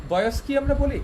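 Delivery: ordinary talk, nothing marked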